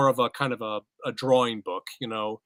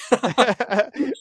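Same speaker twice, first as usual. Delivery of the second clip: high, bright evil giggle; villain laugh; 10 semitones higher, 5 dB louder than usual. Real, not acted. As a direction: chuckle like a sly villain. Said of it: "Spoken like a true villain".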